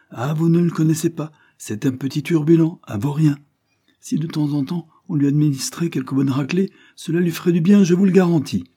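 high, loud indignant rant; a scolding maid who wants to spank; mocking, contemptuous servant voice; harsh servant. Harsh servant Wilson ranting.

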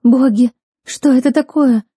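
put-on high, loud, startled girl; frightened gasp. A narrator's reading. Girl shudders at sounds in the dark, frightened high cry. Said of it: "судорожно вздохнула одна из девушек".

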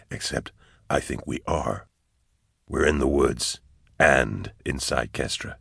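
low, hushed soldier's voice; soft, low, composed reasoning; steady soldier character. A narrator's reading. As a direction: keep it low and measured, working it out. Character's line in narrated story.

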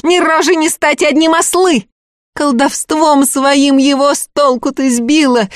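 high, angry peasant woman's tirade; a jealous village woman lashing out; furious accuser. Acted. Akulina at her rival, high furious accusation.

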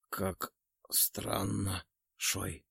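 faint, slow dying voice; quiet. A narrator's reading. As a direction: barely voice the words. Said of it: "тихо проговорил Перк".

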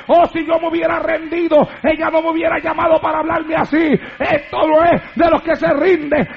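arenga rítmica que crece hasta el grito; prédica a pleno pulmón. Real, not acted. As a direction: predica con fervor, cada frase más fuerte. (sermón encendido y muy fuerte)

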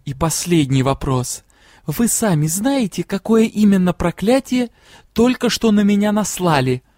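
ordinary delivery

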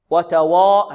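"وتوا" with a thick, dark waw; wrong heavy waw. Shown as a demonstration. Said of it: "هذا خطأ".

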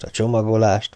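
plain reading voice

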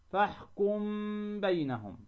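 فاحكم بينهم with a held hum on the m; ikhfa with ghunna. Shown as a demonstration. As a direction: linger on the m of فاحكم, then release into بينهم. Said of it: "هنالك غنة". (إخفاء ميم فاحكم عند الباء مع الغنة)

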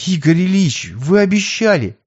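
high, hurt plea; pleading. A narrator's reading. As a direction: beg him to keep promise. Pleading with boss.

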